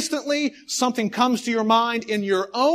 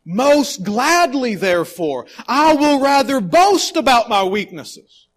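louder, slow, ringing declaration; bold proclamation; 4 semitones higher, 6 dB louder, slower than usual. Acted. Preacher to congregation, boldly reading Paul's boast. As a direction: proclaim it proudly and slowly.

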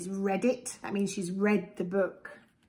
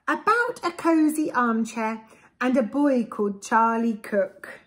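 lively, sing-song storybook read; reading to children; 3 semitones higher, 4 dB louder than usual. A narrator's reading. Parent reading a picture book to a child, lively storybook voice. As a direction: read it aloud to little ones with relish.

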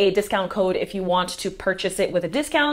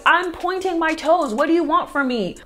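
frustrated whine; 7 semitones higher than usual. Retold or imitated. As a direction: throw up your hands at the teacher. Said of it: "I was so frustrated because I was like".

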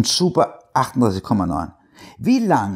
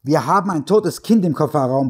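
yelling; 7 semitones higher than usual. Acted. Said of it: "brüllt er sie an".

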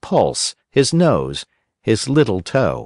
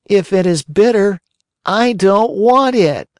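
put-on much higher, louder childish whine; whiny puppet boy; 11 semitones higher, 4 dB louder than usual. A narrator's reading. Pinocchio refuses the fairy's bitter medicine, whining.

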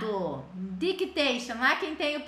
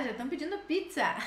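ordinary talk, nothing marked

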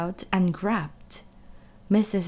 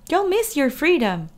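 higher honking goose; 6 semitones higher than usual. A narrator's reading. Goose honking at Wilbur, higher. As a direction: honk the warning. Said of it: "honked the goose".

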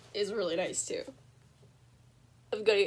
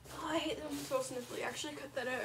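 usual speaking voice

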